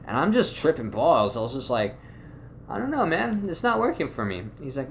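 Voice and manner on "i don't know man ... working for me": high whiny complaint. Retold or imitated. Telling friend drugs not working.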